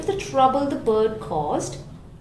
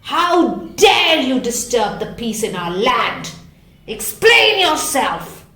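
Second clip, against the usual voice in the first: ferocious Lion; 8 dB louder than usual. Acted. Lion ferociously demanding Bird explain himself. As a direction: bellow the order at Bird. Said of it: "the lion ferociously".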